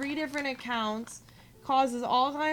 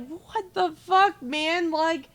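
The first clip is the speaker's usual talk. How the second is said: higher, louder, slow drawn-out outrage; exasperated disbelief; 4 semitones higher, 4 dB louder than usual. Retold or imitated. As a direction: plead in stunned frustration. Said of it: "And I was just like".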